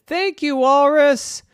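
squeaky sweet thanks; high grateful girl. Acted.

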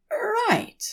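high prim voice, throat cleared; fussy angel clearing throat. Acted. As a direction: settle in primly before reading. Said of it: "clearing his throat".

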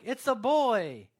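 high, very loud, drawn-out cry; jubilant announcement. Acted. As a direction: proudly proclaim a birth, stretching the words. Acts out birth announcement.

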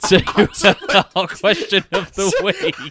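high, loud, slowed by laughter; real giggles breaking up the words; laughing segue. Real, not acted. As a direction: laugh your way through the announcement.

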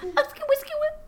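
high squeaky scratch sound; DJ scratch noises. Pretend. Imitating DJ scratch.

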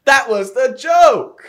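high, loud, stretched-out mock outrage; exasperated shout. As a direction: spell it out slowly at full volume. Exasperated retort to missed joke.

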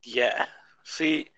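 hoarse, fading voice; losing voice. Real, not acted. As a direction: voice giving out, hoarse. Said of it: "losing my voice".

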